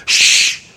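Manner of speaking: loud, forceful shush; exaggerated sharp "shh"; mock crowd shushing hard; hushing. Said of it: "They quickly change".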